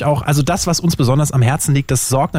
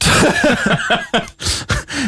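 laughing; 9 semitones higher than usual. Real, not acted. Hornet distracting the hosts.

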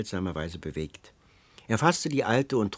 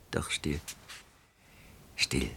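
low, soft hush fading into whisper; 8 semitones lower, 10 dB quieter than usual. A narrator's reading. Old woman breaks off and urges silence, hushed. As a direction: hush it, with long pauses between words.

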